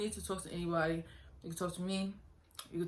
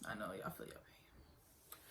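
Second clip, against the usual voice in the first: whisper; 10 semitones lower, 19 dB quieter, slower than usual. Real, not acted.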